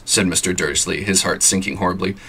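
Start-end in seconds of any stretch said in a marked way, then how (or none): none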